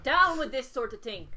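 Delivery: loud put-on Irish, amused; Father Ted quote. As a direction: chant it in sitcom Irish. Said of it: "It's just Father Ted quotes now".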